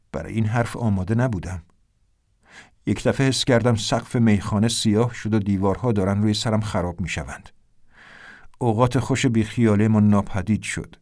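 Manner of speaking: plain reading voice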